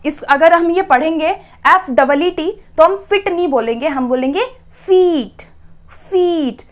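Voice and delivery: ordinary talk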